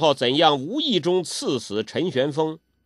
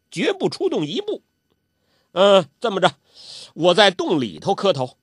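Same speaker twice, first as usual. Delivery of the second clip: put-on high, eager old man's voice; a suddenly happy old prankster hurrying things along; high-pitched playful old urchin; Zhou Botong cheered up; 6 semitones higher than usual.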